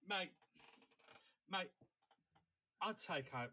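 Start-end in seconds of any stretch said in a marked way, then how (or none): none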